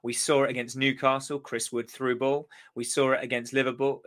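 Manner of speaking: ordinary delivery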